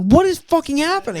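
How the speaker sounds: high-pitched incredulous cry; baffled outburst. Retold or imitated. Viewer reacting to Kate serving gremlins, high incredulous outburst. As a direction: pitch shoots up in mock disbelief.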